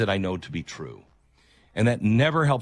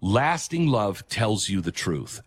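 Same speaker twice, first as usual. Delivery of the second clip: ordinary talk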